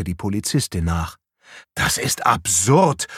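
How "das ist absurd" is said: slow, emphatic outburst; indignant flare-up. A narrator's reading. Patrick protests to the policewoman, flaring up. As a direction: flare up, landing hard on the last word. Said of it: "fuhr Patrick auf".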